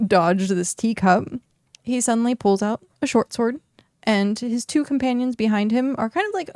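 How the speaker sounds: normal talking voice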